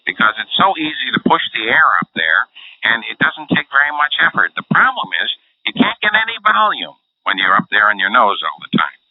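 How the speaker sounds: pinched nasal impression with little power; Snooki. Shown as a demonstration. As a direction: talk right up through the nose. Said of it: "this is the way she talks".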